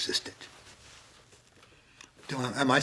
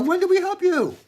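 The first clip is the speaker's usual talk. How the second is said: much higher, loud innocent question; high bewildered sheep; 6 dB louder than usual. Acted. Sheep asking the Lord, high and baffled. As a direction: squeak it like a baffled flock.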